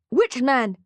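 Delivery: loud, high urgent demand; shouting. Acted. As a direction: shout the question at her. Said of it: "he shouts".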